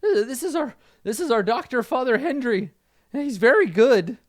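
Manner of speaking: high-pitched, eager aside; Fezzik, confiding. Acted. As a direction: introduce him in a high character voice. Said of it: "he says quietly".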